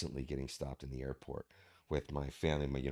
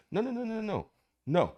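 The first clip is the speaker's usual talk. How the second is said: a much higher run of urgent no's; 4 dB louder than usual. Retold or imitated. Husband stopping his worried wife, urgent high protest. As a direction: hold her back with rapid high no's.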